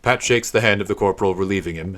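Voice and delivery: ordinary delivery, nothing marked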